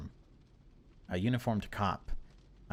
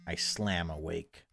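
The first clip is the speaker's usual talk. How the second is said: ordinary delivery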